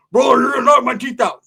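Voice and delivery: high, loud garbled yell; alarmed mock shout; punched man's protest. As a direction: holler it like a man just punched.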